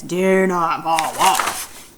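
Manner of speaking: slow, deliberate warning. Shown as a demonstration. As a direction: spell out each word of the warning. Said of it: "read the paragraph where he says".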